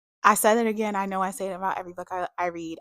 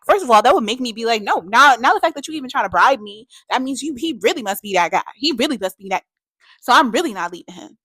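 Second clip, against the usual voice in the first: emphatic, louder imagined comeback; defiant retort; 4 semitones higher, 7 dB louder, faster than usual. Acted. Reader imagining her answer to a bribe, defiant. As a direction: refuse it with loud, lively insistence.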